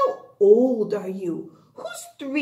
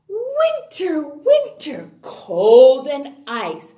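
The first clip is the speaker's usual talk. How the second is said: poem recital; 5 dB louder than usual. Acted.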